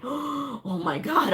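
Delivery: high, drawn-out dismay; mock dismay. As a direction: stretch it out in acted alarm. Retold shock at exam clock.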